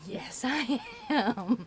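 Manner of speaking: slow laughing yes. Retold or imitated. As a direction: laugh it out slowly. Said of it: "And I'm like".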